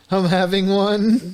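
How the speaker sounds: slow, high, drawn-out announcement; mock fit. Co-host pretending something is happening to him, mock strain. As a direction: stretch it out as if overcome.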